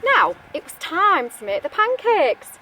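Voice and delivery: ordinary delivery, nothing marked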